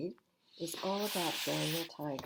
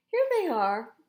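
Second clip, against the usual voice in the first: much higher, louder happy find; excited exclaim; 9 semitones higher, 10 dB louder than usual. A narrator's reading. Prince Wednesday showing the costumes he found, excited. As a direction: announce the treasure found. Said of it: "Prince Wednesday exclaimed".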